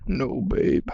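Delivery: low, whispered, mock-deep boyfriend; breathy fake baritone, dead serious; hammed-up deep boyfriend murmur; deep manly voice. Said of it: "You need to trust me and my deep voice".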